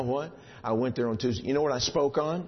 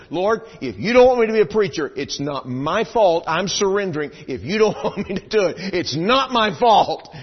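impassioned prayer; 8 semitones higher, 9 dB louder than usual. Real, not acted. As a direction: cry out surrender to the Lord. Surrender prayer climax.

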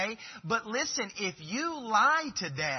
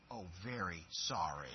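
put-on sheepish child; 10 semitones lower, 11 dB quieter than usual. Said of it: "child's reply".

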